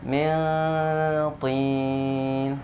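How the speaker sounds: min tin with the n hummed long; correct nasal hold. Shown as a demonstration. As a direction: hum the n before tin. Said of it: "Cara bacanya yang mana yang betul?". (mintin dengan dengung dua harokat)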